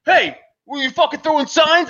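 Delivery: mocking the angry neighbour. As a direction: play the neighbour as a worked-up busybody. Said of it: "the guy was like".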